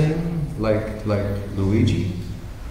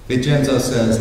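ordinary talk

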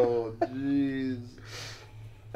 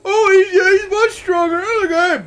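very high, loud excited shout; excited alarm; 15 dB louder than usual. Real, not acted. Player watching the boss come back stronger, shouted alarm. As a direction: yell it out at the top of your range.

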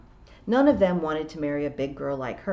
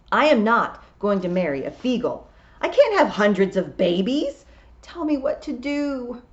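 Tiffany upset; 6 semitones higher, 6 dB louder than usual. A narrator's reading. Tiffany refuses to marry Feegle.

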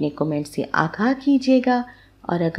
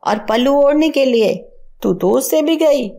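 higher, outraged mother-in-law; shocked scolding; 5 semitones higher than usual. Acted. Mother-in-law scolds her daughter-in-law for wearing jeans, high and shocked.

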